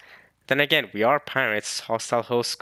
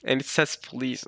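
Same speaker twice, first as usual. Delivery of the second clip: ordinary delivery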